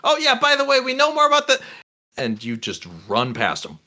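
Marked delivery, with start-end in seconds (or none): 0.0-1.6 high, quick squeaky squirrel chatter. Acted. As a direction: pitch way up like a little squirrel.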